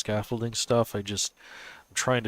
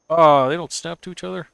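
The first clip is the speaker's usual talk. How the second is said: surprised; 8 semitones higher, 13 dB louder than usual. Real, not acted. Pieces fail to snap together.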